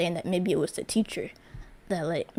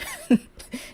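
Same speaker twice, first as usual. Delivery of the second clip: high-pitched burst of laughter; helpless little high laugh; amused laugh; 4 dB louder than usual. Real, not acted. Laughs at her own story.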